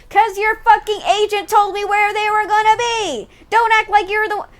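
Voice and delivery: high, loud and furious, shouting down the accuser; outraged yell. Real, not acted. Streamer yelling at the Inquisitor in the game, furious and loud. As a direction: yell it at the screen in real indignation. Yells back at the game's accuser.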